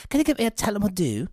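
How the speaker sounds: quick, high-pitched mock Irish lilt; mock Irish accent. Friend mimicking the bar order in a mock Irish accent. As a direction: rattle it off in a put-on Irish accent. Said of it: "And I said with that accent".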